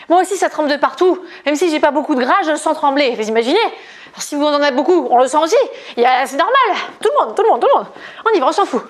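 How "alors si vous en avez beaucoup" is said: usual speaking voice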